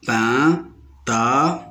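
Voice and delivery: PINTADE slowly split as pin-ta-de; three separate beats in PINTADE, final de voiced; teacher counting out the syllables of PINTADE. Shown as a demonstration. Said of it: "se compose de trois syllabes".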